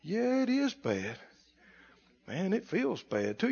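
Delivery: very soft, glum agreement; mock defeated. Believer giving in to the devil's bad report, mock defeated. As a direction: sink into hushed, gullible concession.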